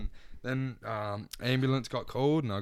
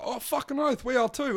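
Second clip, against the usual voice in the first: excited mock yes; 11 semitones higher than usual. Couple's reply in retold story.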